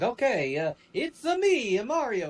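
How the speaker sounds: high-pitched cartoon Italian accent; Mario, Italian accent. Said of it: "This is an Italian, uh, accent".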